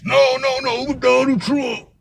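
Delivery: high, drawn-out "no, no, no, Donald Trump"; mock correction. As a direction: sing it up high and stretch it slowly, mocking. Correcting him in the retold argument.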